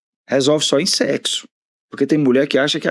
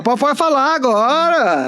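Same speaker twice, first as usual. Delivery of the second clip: pedido meloso imitado; 11 semitones higher, slower than usual. Retold or imitated. Mimics wife coaxing him.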